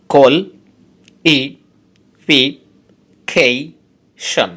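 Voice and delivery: কল ই পি কেশন said as separate slow syllables; teacher splitting a word into syllables. Shown as a demonstration. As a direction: count out each syllable of the word for the class. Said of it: "এই ওয়ার্ডটাতে আছে পাঁচটি syllable".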